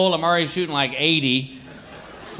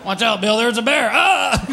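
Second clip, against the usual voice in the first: mocking friend; 4 semitones higher, slower than usual. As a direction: tease him with fake fear. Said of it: "He's like".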